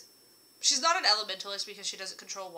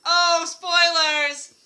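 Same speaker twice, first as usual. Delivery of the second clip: much higher, louder, drawn-out gasp; mock alarm; 8 semitones higher, 15 dB louder, much slower than usual. Real, not acted. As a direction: playfully scold yourself. Catching herself giving spoilers.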